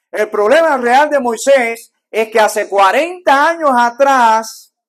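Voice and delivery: loud, driving sermon delivery; preaching at full force. Acted. Preacher telling the congregation about Moses, loud full-force preaching. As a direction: thunder it from the pulpit.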